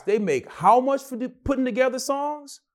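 high-pitched stunned disbelief, stretched at the start; astonished. Retold or imitated. Stunned on learning producers' pay.

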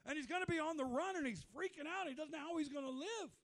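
loud, high astonished exclaim; preacher marveling at God's words; amazed. Retold or imitated. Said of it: "I'm like".

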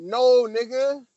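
drawn out, slow and loud "No"; slow emphatic no. Retold or imitated. Warning the character not to fall for it, slow emphatic no. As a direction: warn him off slowly. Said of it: "I'm like".

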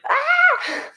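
short high scream; high startled shriek; mock scream. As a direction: let out a sharp yelp of fright. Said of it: "I was like".

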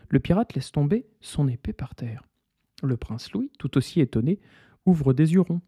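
plain reading voice

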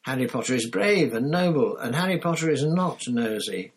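high, squeaky elf voice, firmly loyal; a devoted house elf standing up for Harry; squeaky earnest defence. Acted.

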